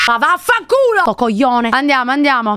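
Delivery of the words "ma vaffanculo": high, jolted swear; startled cursing. Real, not acted. Streamer to the thing that scared her, startled cursing. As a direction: curse whatever frightened you.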